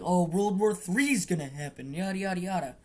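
hammed-up alarm trailing off into yada yada; mock panic. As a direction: brush off the doom talk with a put-on voice. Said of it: "everyone was like".

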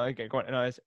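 ordinary talk

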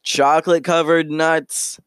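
high-pitched hawker's cry; vendor calling out. Acted. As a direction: pitch it up to the whole street. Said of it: "one of them calls out".

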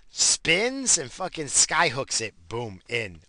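usual speaking voice

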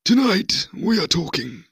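high, loud documentary presenter take-off; David Attenborough impression. Shown as a demonstration. Host showing off an impression to the guest, mock documentary narrator. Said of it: "my David Attenborough impression".

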